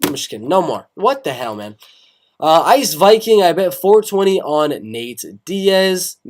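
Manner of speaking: normal talking voice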